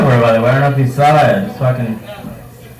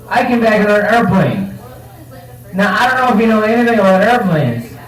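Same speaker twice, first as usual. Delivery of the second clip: excited rant; 8 semitones higher than usual. Real, not acted.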